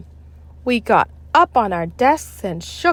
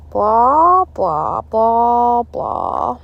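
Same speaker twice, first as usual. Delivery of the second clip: teacher droning; 3 semitones higher, 5 dB louder, much slower than usual. A narrator's reading. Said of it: "said Miss Banks".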